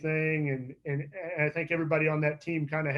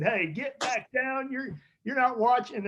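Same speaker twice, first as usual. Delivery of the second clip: retold yell; 8 semitones higher, slower than usual. Pitching coach catches player watching TV mid-game, mock yell. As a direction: imitate a coach calling out, pitched well up. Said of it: "he, he yelled at me".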